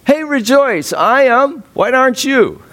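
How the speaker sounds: high, bright, beaming invitation; joyful voice. Retold or imitated. The voice from prison urging hearers, high and joyful. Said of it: "comes this voice saying".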